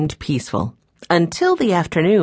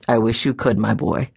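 put-on low, subdued old man's voice; a touch of weary sadness; sad grandfather; 6 semitones lower than usual. A narrator's reading. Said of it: "said Mister Alden sadly".

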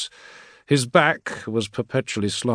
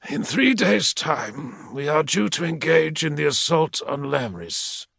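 lightly voiced warlord's aide, measured threat; menacing equerry; 6 semitones higher than usual. Equerry rebuking a petitioner, lightly voiced menace. Malaghurst speaking to a logistician.

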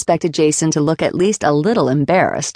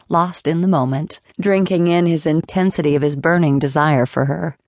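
plain reading voice

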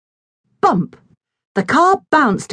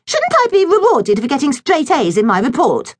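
high, complaining little sister; whiny girl voice; 7 semitones higher than usual. A narrator's reading. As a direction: whine it like a spoilt girl. Millie voiced in the story.

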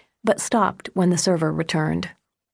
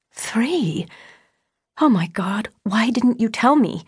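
higher-pitched, alarmed outburst; shocked cry; 5 semitones higher than usual. A narrator's reading. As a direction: cry out in disbelief, without shouting. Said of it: "Susan cried".

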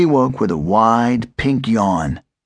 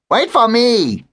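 high squawky bird call, much higher; a little bird crying out to wait; 12 semitones higher than usual. A narrator's reading. Gabby calls after Ernest, high bird voice.

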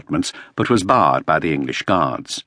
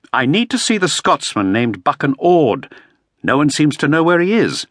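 a touch higher, urgent inquiring man; Shakespeare pressing for answers, pitched up; Shakespeare, lightly voiced; 8 semitones higher than usual. A narrator's reading. Shakespeare asking the Earl where Ord is, urgently.

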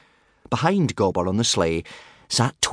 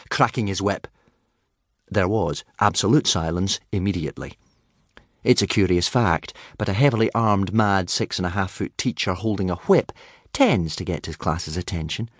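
usual speaking voice

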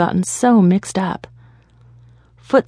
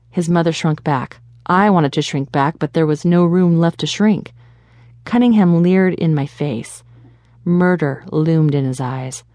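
ordinary delivery, nothing marked

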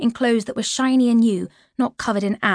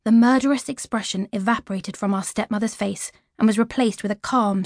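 ordinary delivery, nothing marked

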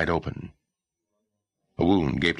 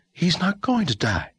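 put-on quick reassuring girl. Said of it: "Rooney said quickly".

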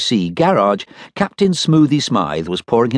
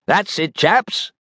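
captain's voice; 9 semitones higher, much slower than usual. Acted. Captain praises mechanics.

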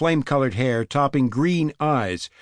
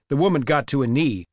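ordinary delivery

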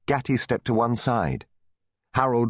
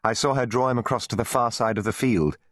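usual speaking voice

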